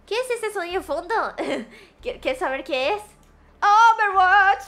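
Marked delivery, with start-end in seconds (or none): 3.6-4.6 loud, drawn-out call; showy announcement. Acted. Streamer naming the background sound, loud showy announcement. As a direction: announce the title loud and long. Calls out game name as answer.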